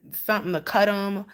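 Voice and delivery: usual speaking voice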